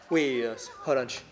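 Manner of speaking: ordinary talk, nothing marked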